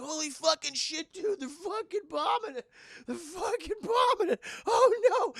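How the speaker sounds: high, loud, building to a laughing yell; shocked excitement. Retold or imitated. Survivors watching jets bomb the monster, rising excited yell.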